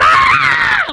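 high panicked scream; inward scream. Retold or imitated. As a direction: let out a shrill panicked yell. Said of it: "like this, you know".

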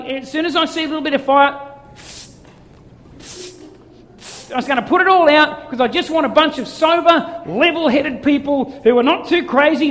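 lightly acted killjoy God, high and loud; hammed-up complaint from on high; disapproving God. God looking down on joyful people, mock disapproval. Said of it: "He's not sitting up there".

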